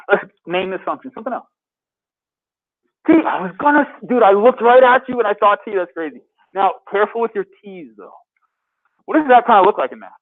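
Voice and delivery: ordinary talk, nothing marked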